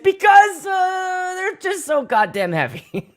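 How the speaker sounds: high, drawn-out mock complaint with a laugh at the end; exasperated whine. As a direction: whine it like the gear weighs a ton. Exasperated about heavy gear.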